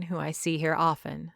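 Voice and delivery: usual speaking voice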